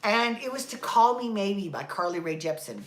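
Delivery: ordinary delivery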